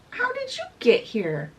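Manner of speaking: high-pitched, acted astonishment; amazed question. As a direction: ask it high, amazed at who turned up. Boy astonished to see her.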